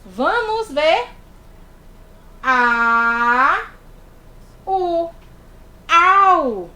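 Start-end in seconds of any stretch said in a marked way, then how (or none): 2.4-6.7 A and U held loud, then blended into "au"; vowels A, U, then a loud "au"; sounding out vowels. Shown as a demonstration. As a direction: build the word from its vowels, loudly. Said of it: "Quando foi que eu ouvi esse au?".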